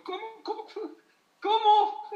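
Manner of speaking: high, loud disbelieving cry of what; incredulous shout. Acted.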